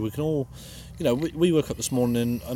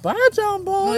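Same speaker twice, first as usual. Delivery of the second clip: sing-song goodnight; 3 dB louder, slower than usual. Pretend.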